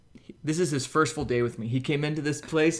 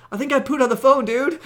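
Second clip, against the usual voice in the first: higher, louder call through a door; called from the shower; 10 semitones higher, 6 dB louder than usual. Retold or imitated. Calling to his companion from the bathroom, raised call. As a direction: shout it from the shower stall. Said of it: "you could hear me say from the shower".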